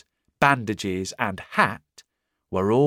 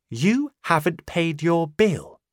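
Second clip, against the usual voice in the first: higher, firm woman's voice; firm landlady; 6 semitones higher than usual. Acted. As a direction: refuse him flatly in a higher voice. Landlady refuses.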